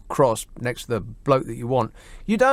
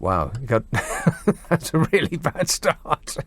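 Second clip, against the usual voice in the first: laughing. Real, not acted. Host reacts to caller's botched opening, laughing. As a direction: laugh while saying it.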